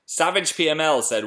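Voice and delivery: usual speaking voice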